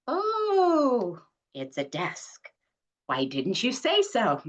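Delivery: high drawn-out oh, then dropping to a relieved grumble; the penny drops at last; mother realizing. Acted. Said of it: "Brett and Mom in Assisted Living".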